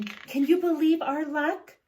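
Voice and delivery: high, loud delighted exclamation; thrilled about the luck. Acted. Wife thrilled to neighbour, loud and high.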